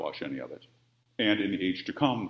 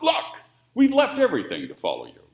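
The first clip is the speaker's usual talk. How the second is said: fast, louder blurt; impulsive blurt; 11 semitones higher, 9 dB louder, faster than usual. Retold or imitated. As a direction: blurt it out without thinking. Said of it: "blurts out".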